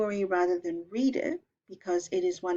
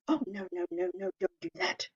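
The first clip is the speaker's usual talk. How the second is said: much higher, softer mock frightened plea; pleading goat; 10 semitones higher, 5 dB quieter, faster than usual. Middle goat begging the troll, high and soft. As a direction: beg the troll, small and scared.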